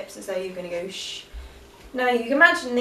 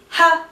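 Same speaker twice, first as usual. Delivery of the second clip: forceful ha; 7 semitones higher, 10 dB louder than usual. Shown as a demonstration. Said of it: "the ha ha".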